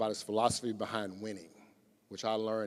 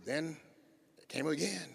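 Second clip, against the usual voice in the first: usual speaking voice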